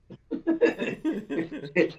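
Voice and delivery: a high burst of real laughter. Real, not acted. Host bursts out laughing at his guest's phrase.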